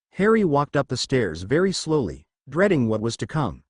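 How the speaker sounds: ordinary delivery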